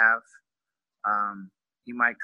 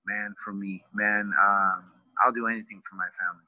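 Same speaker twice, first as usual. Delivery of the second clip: normal talking voice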